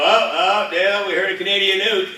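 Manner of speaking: high, loud mock fault-finding; nitpicking executives. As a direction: pounce on a pronunciation slip like a fussy executive. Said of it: "and they go".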